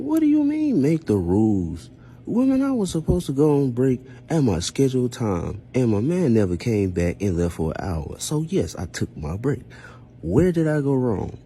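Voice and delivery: high, loud, indignant protest at the boss; exasperated outburst. Retold or imitated. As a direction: throw it back at her in disbelief. Arguing back at supervisor, exasperated.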